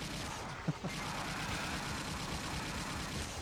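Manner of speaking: quiet unvoiced wheezing laugh; breathless laugh. Real, not acted. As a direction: wheeze out a giggle. Laughing at own insult.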